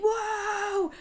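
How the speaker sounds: high drawn-out crowd whoa; crowd going whoa. Retold or imitated. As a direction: imitate a stunned arena crowd. Imitating the crowd's reaction.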